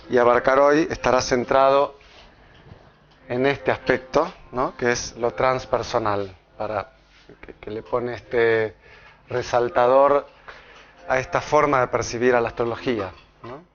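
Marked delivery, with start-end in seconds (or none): none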